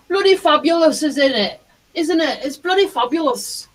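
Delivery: high-pitched, badly faked English accent; terrible English accent. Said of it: "Terrible accent".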